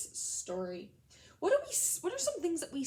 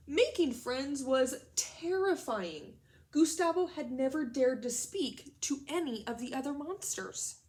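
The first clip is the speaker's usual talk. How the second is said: slow, loud, expressive storybook reading; dramatic read-aloud to children; story narration; 2 semitones higher, 5 dB louder, slower than usual. A narrator's reading. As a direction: read it big and slow for the class. Reads the story aloud to class.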